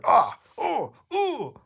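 rising high mock cries, first one loud; hammed-up screams. Reader acting out Bendy's scream, high hammy cries. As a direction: yelp up the scale. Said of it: "scream at the top of his lungs".

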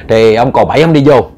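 ordinary delivery

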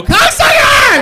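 huge, very high shouted insult; full shout. Retold or imitated. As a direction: yell it and bolt. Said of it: "Just go".

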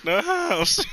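mock voice crack. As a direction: squeak it like a breaking voice. Mocking friend's cracked word.

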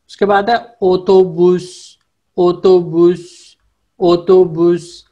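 ओतोबुस said slowly, each o round and clear; slow teacher model of ओतोबुस; Turkish word demo. Shown as a demonstration. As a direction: stretch ओतोबुस out, o-to-bus. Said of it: "ये English वाला ओ उसी की तरह ही pronounce होता है".

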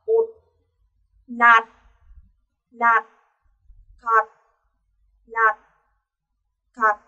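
"Ột, nạt, khạt", each short and closed on t; isolated "Ột, nạt, khạt" ending in chữ tờ; final t. Shown as a demonstration. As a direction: drill "nạt" and "khạt" one by one, stopping on an unreleased t. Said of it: "Mình đọc chuyển thành chữ tờ".

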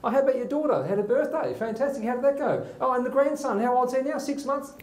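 lightly voiced chatty old man; sharp and eager. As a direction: fire off the questions brightly. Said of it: "He's, he's firing off".

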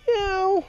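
high-pitched cat meow to call the kittens; squeaky meow calling kittens. Real, not acted.

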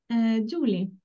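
normal talking voice

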